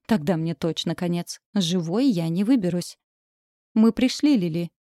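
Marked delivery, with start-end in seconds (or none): 3.7-4.7 flat mechanical villain voice; robot voice. Acted. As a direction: speak like a cold robot. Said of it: "голос робота".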